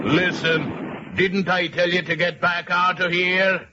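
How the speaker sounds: fed-up police captain scolding; irritated captain. Acted. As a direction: bark at the pest to get lost. Angry captain snaps at professor.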